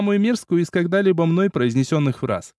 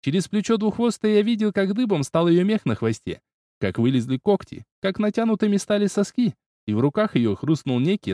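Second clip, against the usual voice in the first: ordinary delivery, nothing marked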